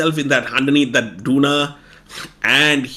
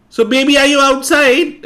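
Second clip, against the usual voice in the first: high, loud playful call; 13 semitones higher, 10 dB louder than usual. Acted. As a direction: pretend to look outside. Mother searching aloud.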